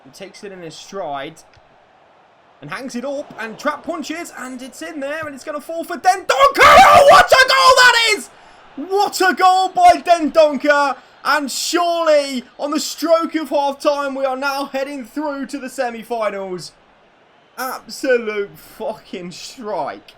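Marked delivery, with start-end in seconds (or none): 2.6-6.3 rising from eager to a high fast shout; building excitement. Real, not acted. Commentating a goal build-up. 6.3-16.7 huge high shouted celebration, slowed and stretched; ecstatic goal scream. Real, not acted. 17.6-20.1 slow, emphatic, louder; awed praise. Real, not acted. After goal celebration.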